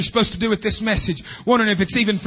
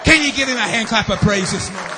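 full-force preaching; 6 semitones higher than usual. Acted. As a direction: drive it home like a revival preacher rebuking Satan.

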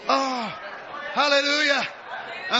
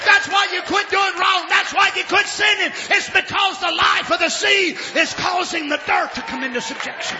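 fiery revival preaching; preaching at full force; 8 semitones higher than usual. Acted. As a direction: preach it hard to the congregation.

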